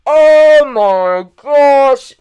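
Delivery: high, long drawn-out yell; over-the-top shocked shout; freaking out. Retold or imitated. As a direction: stretch every word into a loud, horrified cry. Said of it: "like, freaking freaking out".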